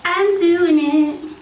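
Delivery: high, loud, drawn-out declaration; nervous excitement. Real, not acted. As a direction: hype yourself up nervously. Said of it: "I was super nervous".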